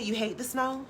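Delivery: ordinary delivery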